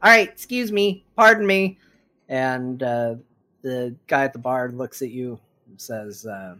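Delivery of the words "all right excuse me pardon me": high, squeaky little gnome squeezing past. Acted. Gnome politely pushing past the party at the bar, high and squeaky.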